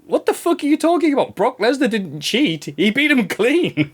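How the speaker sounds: high, exasperated disbelief, slowing on the last words; mock outrage at a clueless fan; incredulous rant. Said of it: "I'm like".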